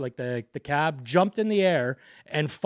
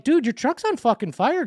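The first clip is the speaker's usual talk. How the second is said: higher-pitched, urgent re-enacted warning; retold urgent warning; 9 semitones higher than usual. Warning the driver away from his burning truck, urgent and higher. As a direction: warn him like he's not getting it.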